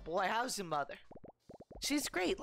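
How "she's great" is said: character voice. Acted. Voicing NPC in game.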